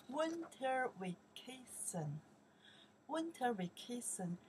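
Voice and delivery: slow model read for students; slow teaching pace. Shown as a demonstration. Teacher drawing out the English for the class, slowly. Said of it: "very slow when I pronounce the Chinese".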